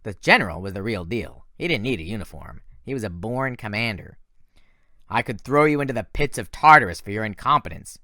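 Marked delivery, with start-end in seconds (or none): 5.1-8.0 menacing threat. Acted. General threatens Thorne.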